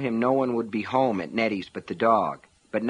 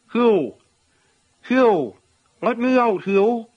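muffled call, loud and high; shouting from behind a closet door; 11 semitones higher, 8 dB louder, slower than usual. A narrator's reading. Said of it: "a muffled voice began to call".

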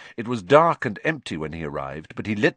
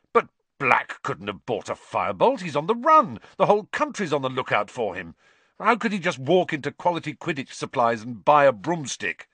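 urgent protest; 6 semitones higher, 3 dB louder than usual. Acted.

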